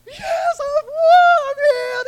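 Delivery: a healed man losing it with joy, near crying; freaking out. Retold or imitated. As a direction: ecstatic high yelp. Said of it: "He's freaking out".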